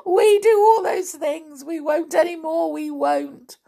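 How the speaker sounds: put-on high, wailing frightened boys; sobbing confession. A narrator's reading. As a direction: plead in an acted sob, easing off. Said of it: "sobbed Len and Tom".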